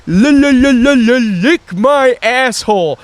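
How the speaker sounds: high, loud, slow mocking stutter; hammed-up stammering insult; stuttering kid. Mimicking the stuttering kid's comeback, a high exaggerated stutter. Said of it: "this kid starts stuttering".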